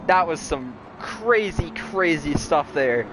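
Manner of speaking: winding down race call; play-by-play commentary. Acted. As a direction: read the results, easing off. Results after race.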